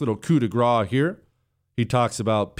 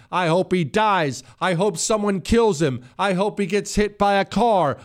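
mock Democrat voice; 10 semitones higher than usual. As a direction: mimic them gleefully wishing harm. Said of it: "has heard them speak like this".

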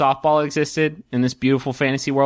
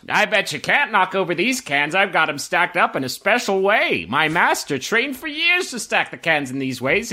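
higher-pitched carnival barker's pitch; enticing game vendor; 5 semitones higher than usual. Acted. Vendor boasting of stacked cans to a player, high barker's patter. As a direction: hawk the rigged game, rising higher toward the price. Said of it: "one particularly enticing vendor who's".

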